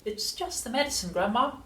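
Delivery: put-on unruffled storybook child; George reassuring Grandma. A narrator's reading. George calmly answering his shrieking grandmother.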